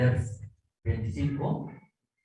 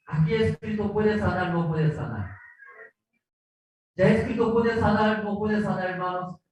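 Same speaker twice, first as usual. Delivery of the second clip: excited gossip; 6 semitones higher than usual. Acted. Townspeople gossip about Christ.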